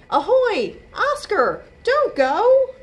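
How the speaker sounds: high, loud call after him. Acted. Boy calls to octopus leaving, loud high shout. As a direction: call out as he swims off.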